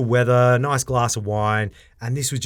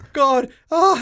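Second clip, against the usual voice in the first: mock whimper; 5 dB louder than usual. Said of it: "he's whimpering 'cause he's about to fall".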